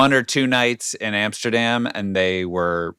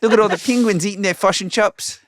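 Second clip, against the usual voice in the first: clipped, higher New Zealand accent; 10 semitones higher than usual. Shown as a demonstration. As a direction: mimic the Kiwi, short and bright. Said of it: "it's all a little bit clipped and shorter".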